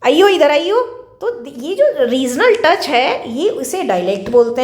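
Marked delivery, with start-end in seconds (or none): 0.1-0.9 Bijnor dialect. Shown as a demonstration. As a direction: call them over in Bijnor Hindi. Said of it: "यही बिजनौर की तरफ जाएंगे".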